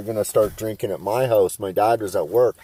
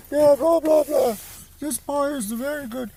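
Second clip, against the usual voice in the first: put-on very high, prim old-lady chatter; old English lady. Buddy's old English mom chattering on about pie, very high. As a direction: babble on like a dotty old lady. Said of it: "still she's going on".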